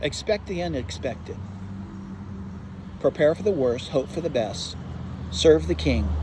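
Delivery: low, earnest closing words to the congregation. Acted. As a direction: settle the flock with calm warnings. Closing exhortation, lower and softer.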